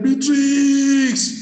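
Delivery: high, long-drawn call of the name; acted-out urgent holler; imitated pastor's shout. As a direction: shout it out as if summoning help, stretching the last syllable. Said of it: "Pastor shouted".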